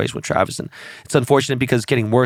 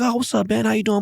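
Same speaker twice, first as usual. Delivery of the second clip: higher-pitched hey-man hello; imitated casual greeting; 9 semitones higher than usual. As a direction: replay a friend's easy greeting. Acts out a remembered greeting.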